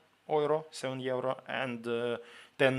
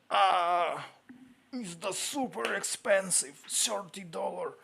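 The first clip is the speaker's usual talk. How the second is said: astonished; 6 semitones higher than usual. Real, not acted. Shocked at New Zealand prices.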